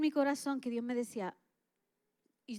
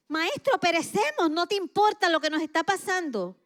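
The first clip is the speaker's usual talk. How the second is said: panicked disciples; 7 semitones higher, 8 dB louder than usual. Retold or imitated. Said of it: "comenzaron a decir".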